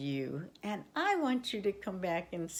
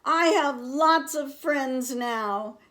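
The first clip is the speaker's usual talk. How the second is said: louder and higher, slow and proud; very loud, happy; 8 semitones higher, 10 dB louder than usual. A narrator's reading. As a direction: shout it out with joy across the pond. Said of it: "he said it again very loudly".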